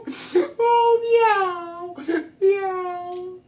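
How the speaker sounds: high, drawn-out wailing meow; crying, meowing cat. Acted. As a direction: yowl like a miserable cat. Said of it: "just a crying, a meowing".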